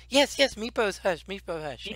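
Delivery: high, soft kobold echo; meek kobold. Acted. Meepo meekly repeating after being hushed, high and soft. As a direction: whimper it back like a scolded pet.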